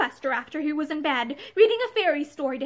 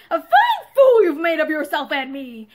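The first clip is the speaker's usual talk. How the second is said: loud, furious rant; raving; 8 dB louder than usual. A narrator's reading. Husband rages at Pansy over the lost deal, loud. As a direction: rage at her for the blunder. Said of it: "he raved".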